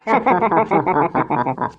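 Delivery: long burst of cackling laughs; mocking laughter. Prankster laughing at his terrified friend, mocking laughter.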